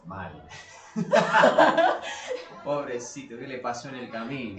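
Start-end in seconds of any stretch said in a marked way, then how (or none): 0.5-2.6 loud, much higher burst of laughter. Real, not acted. Co-host laughing about Lugones' decline.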